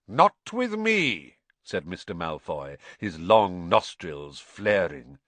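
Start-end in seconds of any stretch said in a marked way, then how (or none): 0.1-1.4 high, slow, scornful retort; haughty disdain. Acted. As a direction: sniff it out with disdain. Malfoy answers proudly.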